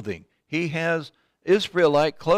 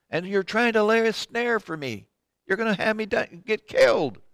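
alarmed accusation; 6 semitones higher than usual. Acted. Acted accusation of the disguised king.

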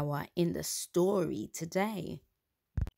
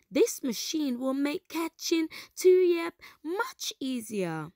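delighted; 8 semitones higher, 3 dB louder than usual. Acted. Kida delighted after clapping.

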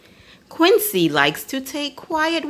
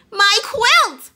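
higher, louder alarmed cry; 11 semitones higher, 4 dB louder than usual. A narrator's reading. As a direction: call out as the quilt is snatched. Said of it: "cries Quincy".

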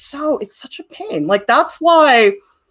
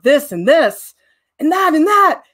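higher, rattling off every flaw in rising panic; panicky inner monologue; 10 semitones higher than usual. Acted. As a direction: list the problems as a frantic inner voice. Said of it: "That's my inner monologue".

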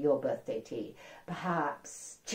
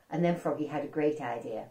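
ordinary delivery, nothing marked

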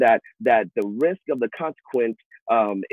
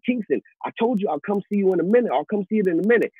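high, quick exasperated brush-off; irritated dad; 9 semitones higher, faster than usual. Retold or imitated. A father telling his son to wait, exasperated. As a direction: wave the kid away, annoyed.

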